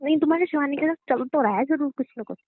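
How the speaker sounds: ordinary delivery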